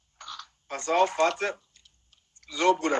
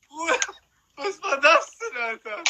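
laughing, high and stunned; stunned laughing disbelief; 3 dB louder than usual. Real, not acted. Stunned by magic trick.

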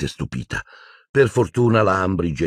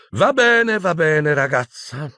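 impatient villainess; 11 semitones higher, slower than usual. Acted. Umbridge brushing off Hermione's apology, high impatient voice. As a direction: cut off the tears, high and impatient.

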